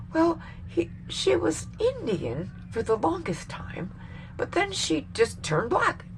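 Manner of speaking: high, soft drawn-out impression; Donald Trump. Retelling his interview remark about Kamala Harris, mock Trump voice. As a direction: lift it high and keep it hushed. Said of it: "and he goes".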